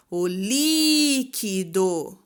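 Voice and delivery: "líquido" called out very slowly, LÍ held long, then KI and DO apart; drawn-out "líquido", all weight on LÍ; calling out stressed syllable. Shown as a demonstration. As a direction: call out "líquido", stretching LÍ and separating the rest. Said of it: "Qual que é a sílaba tônica?".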